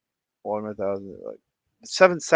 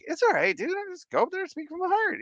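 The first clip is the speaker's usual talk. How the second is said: much higher, fast, breezy mock reassurance; reassuring; 4 dB quieter, faster than usual. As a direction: brush off the worry lightly. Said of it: "I'm like".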